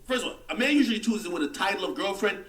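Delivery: ordinary delivery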